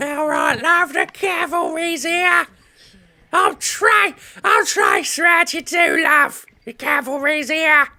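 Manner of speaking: loud, very high-pitched hammed-up British accent; mock British accent. Mocking a game character's suspect accent, hammed-up high British shout. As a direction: shout it in a squeaky exaggerated British voice. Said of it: "A lot of the accents sound suspect".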